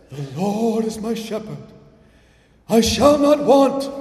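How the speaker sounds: the grade-three teacher reading the verse, airy and pious; breathy teacher's Bible voice. Retold or imitated. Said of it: "breathy voice whenever he read the Bible".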